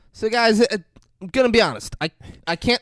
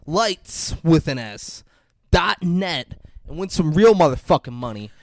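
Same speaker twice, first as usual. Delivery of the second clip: hyped pitchman, a bit higher; mock ad read; 4 semitones higher than usual. Plugging a gambling site, hammed-up ad voice. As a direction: sell it like a cheesy commercial.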